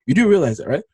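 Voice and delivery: ordinary talk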